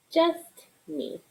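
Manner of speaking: ordinary delivery, nothing marked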